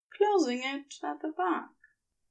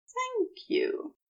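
higher, softer Aziraphale voice with anticipation; eager thank you; 13 semitones higher, 4 dB quieter than usual. Acted. Aziraphale thanking Crowley, higher and softer, eager. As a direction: lift it up, asking to be told. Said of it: "said with such curious anticipation".

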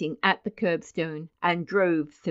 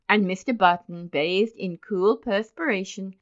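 usual speaking voice

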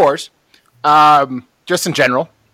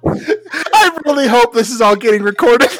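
trying not to laugh; 6 semitones higher, 3 dB louder than usual. Real, not acted. Group laughing fit.

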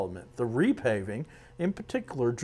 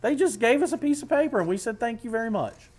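high fast joke; 9 semitones higher, faster than usual. Retold or imitated. Joking retelling.

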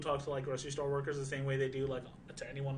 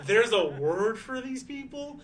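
loud, slow, high disbelief; mock outraged wonder; incredulous outburst; 9 semitones higher, 9 dB louder, slower than usual. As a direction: shout it in stunned disbelief. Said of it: "I was like".

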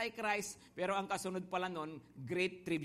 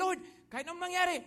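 eager promise; 10 semitones higher, much faster than usual. Retold or imitated. Disciples promise never to leave.

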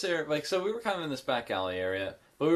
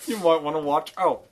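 higher, louder British warning; mock London local warning the graffiti artist; mock British accent; 4 semitones higher, 6 dB louder than usual. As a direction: caution them like a London local. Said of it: "doing a really good British accent".

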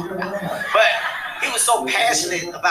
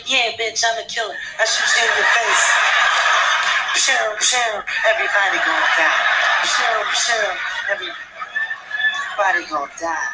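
a hint of a tough rapper, louder; small-voiced rapper; 4 dB louder, slower than usual. Comic pretending to rap tough with a small voice, hammed-up. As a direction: play a gangsta rapper with a small voice. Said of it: "my voice is too small".

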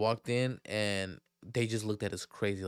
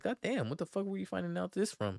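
much higher disbelieving what the fuck; mock shock; shocked reaction; 8 semitones higher, 3 dB quieter than usual. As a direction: react stunned. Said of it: "I was like".

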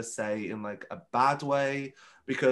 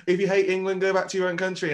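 loud, raised mock bigot; 6 semitones higher, 6 dB louder than usual. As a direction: bark it like an angry troll. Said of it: "And then it's like".

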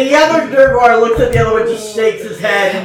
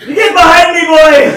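put-on louder, higher bark to the boy; protective shout; 5 semitones higher, 8 dB louder than usual. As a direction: shove the boy back with a shout. Retaking guard's shout.

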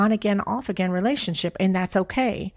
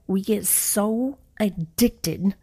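slow emphatic repeat; much slower than usual. Shown as a demonstration. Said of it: "I'm gonna say that again".

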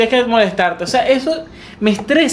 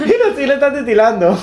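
real laugh running into the words; higher, laughing through the teasing; 4 semitones higher than usual. Real, not acted. Friend teasing another about a twitching eye, laughing.

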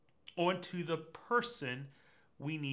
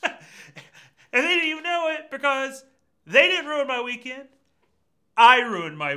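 loud; 10 semitones higher, 12 dB louder than usual. Acted. Preacher builds to loud punch.

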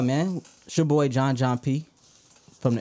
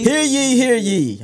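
loud, high royal proclamation; town crier; 12 semitones higher, 8 dB louder, slower than usual. Acted. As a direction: hear ye called out like a herald. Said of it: "Lord Minister Kenke".

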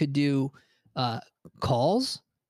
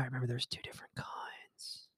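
whispered quick aside; 14 dB quieter than usual. Real, not acted. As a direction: drop to a whisper, remembering. Sudden memory aside.

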